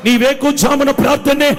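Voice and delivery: from steady to loud, rising fervour; building preaching. Acted. Preacher urging morning prayer, building to a loud cry.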